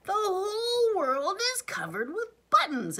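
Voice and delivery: very high, very loud scream. A narrator's reading. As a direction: shriek it in a tantrum. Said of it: "jumped up and down and screamed".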